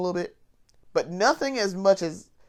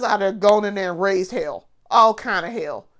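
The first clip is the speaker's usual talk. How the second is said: ordinary talk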